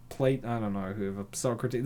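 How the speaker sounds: usual speaking voice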